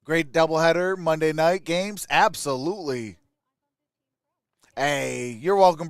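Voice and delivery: normal talking voice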